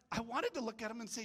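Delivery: usual speaking voice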